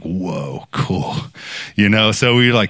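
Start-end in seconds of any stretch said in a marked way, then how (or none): 0.0-1.2 low, soft awe, imitated; understated, drawn-back wonder; hushed amazement. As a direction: let it out softly, impressed. Group reacting to the surprise confession.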